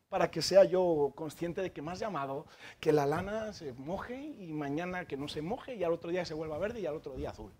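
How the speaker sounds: voz grave y baja de pedigüeño; petición recitada. Retold or imitated. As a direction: enumera condiciones bajando cada vez más. Gedeón pide señales a Dios. (retahíla grave entre dientes)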